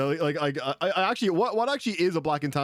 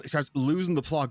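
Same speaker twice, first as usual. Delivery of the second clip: normal talking voice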